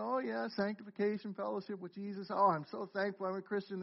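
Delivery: a lightly voiced complacent churchgoer; smug listener. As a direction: voice the comfortable listener under your breath. Imagined complacent listeners' thoughts.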